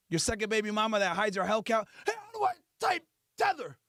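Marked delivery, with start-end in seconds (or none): 2.0-3.8 high, needy call to another room; mock clueless man, pitched up; calling for help. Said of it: "You gotta ask".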